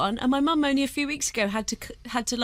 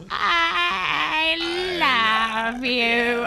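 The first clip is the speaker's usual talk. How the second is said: broken toy voice; much slower than usual. Retold or imitated. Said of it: "by the end of it, it was like".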